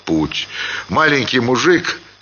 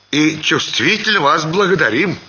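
tipsy peasant; 6 semitones higher than usual. A narrator's reading. Tipsy peasant thanking the schoolmistress.

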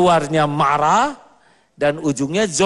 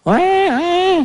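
mock wailing child; 4 dB louder than usual. Child's tantrum cry mocked.

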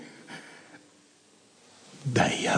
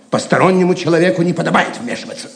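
indignant rebuke; 6 semitones higher, 8 dB louder, much faster than usual. Acted. Седрик резко отвергает вмешательство.

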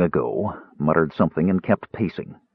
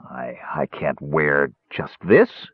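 slow, low, embarrassed stammer; 6 semitones lower, 3 dB quieter, much slower than usual. A narrator's reading. Caramon balks at the skimpy costume, stammering. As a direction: stumble slowly over the first word. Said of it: "he stammered".

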